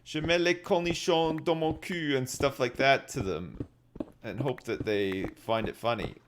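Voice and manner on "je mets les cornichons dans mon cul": lightly hammed French, a bit higher; a light mock French accent; mock French phrase. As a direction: rattle off French in a joking put-on voice. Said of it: "I gotta say things like".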